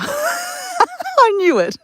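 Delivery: very high, drawn-out laughing squeal; real laughing. Real, not acted. Reading the chat's teasing, real squealing laughter. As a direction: burst out laughing through the words.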